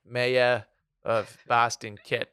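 slow, hammed-up Boston accent that drifts toward New York; bad Boston accent. Mocking the former mayor of Boston in a bad Boston accent. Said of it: "I can't do Boston well".

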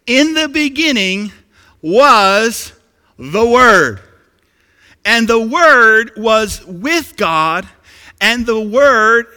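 loud, slow, weighty proclamation; preaching scripture. Acted. Preacher reads scripture to the congregation, loud proclamation. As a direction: declaim it with each key word drawn out.

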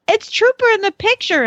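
put-on a small boy pointing at the sign; excited little boy. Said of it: "he was like".

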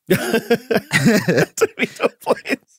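laughing slowly through the words; amused, drawn-out laughing echo. Real, not acted. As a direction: repeat it back through laughter, high and slow. Laughing echo of previous speaker.

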